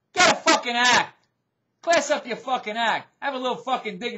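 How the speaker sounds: angry scolding. Real, not acted. Vlogger telling off [name], angry and forceful. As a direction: order her sharply to shape up.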